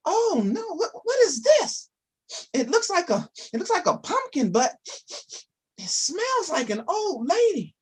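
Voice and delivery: squeaky wondering bear; high puzzled bear. Acted. Bear speaks in story.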